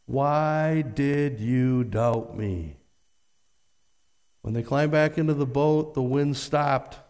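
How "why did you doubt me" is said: slow, low, lightly voiced Jesus asking; Jesus gently rebuking. A narrator's reading. Jesus asking Peter why he doubted, slow and low. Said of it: "Jesus said".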